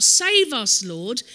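crying out. Acted. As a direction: cry it out over the crowd. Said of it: "they're crying out".

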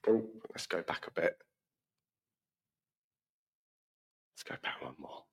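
a real whispered aside to himself. Real, not acted. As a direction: whisper it under your breath while fumbling the slides. Aside while changing slides.

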